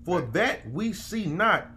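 slow, high, preacher cadence; preaching scripture. Acted. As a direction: read it like a sermon from the pulpit. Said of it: "He said, he said".

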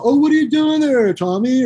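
high, teasing mock voice; mocking bandmate.

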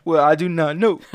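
hammed-up loud brush-off; mock cocky reply. Danny brushing off Sandy, mock loud and cocky.

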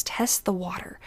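hushed, breathy whisper of advice; whispered aside. Acted. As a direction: whisper it like a little secret tip. Whispered aside while giving swim advice.

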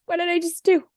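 high, retold panicked question; a touch of imitated panic; mock panic. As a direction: relive the sudden fear. Retold panic after quitting.

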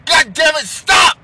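loud, high, exasperated yell; furious shout. Acted. Man losing it at a nagging woman, furious shout. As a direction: blow up and bark stop.

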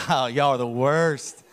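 slow, chuckling mock complaint from a host; laughing mock scold. Preacher imitating a shoe-strict host, laughing. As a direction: laugh through the fake scolding.

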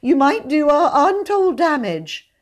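high, faltering warning; frightened, loud, high-pitched protest; pleading. A narrator's reading. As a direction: plead anxiously with the captor. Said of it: "he pleaded as he went".

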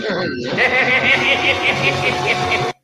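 big high-pitched burst of laughter; real loud cackle. Real, not acted.

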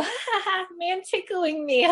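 put-on high giggling toddler voice. Mother imitates her toddler being tickled, high giggle. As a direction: giggle it up high like a little kid. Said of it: "my son would be, like, laughing".

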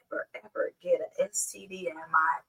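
quick, hushed whisper. Real, not acted. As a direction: whisper it fast like a prayer. Whispered prayer.

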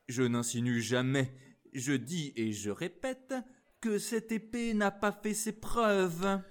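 the officer goading him; taunting. Acted. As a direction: lean on n'a pas like a challenge. Officer provokes D'Artagnan.

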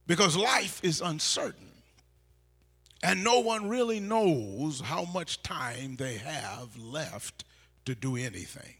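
ordinary talk

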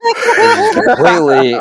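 high, loud laugh through the words. Real, not acted. Teller bursting out laughing at the chokehold. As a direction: crack up mid-sentence.

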